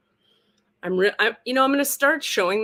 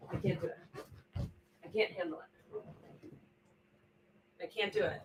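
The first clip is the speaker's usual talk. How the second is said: quiet mock despair; 13 dB quieter than usual. Real, not acted. Despair over crooked pictures.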